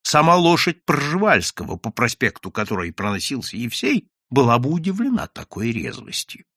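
plain reading voice

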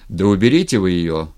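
loud barked order without raising pitch; gruffly yelled order; angry yell. A narrator's reading. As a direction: snap out a loud, irritated command. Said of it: "заорал Степан".

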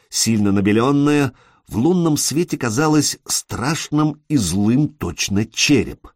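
plain reading voice

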